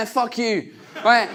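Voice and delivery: normal talking voice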